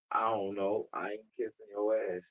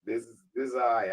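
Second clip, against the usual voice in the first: ordinary delivery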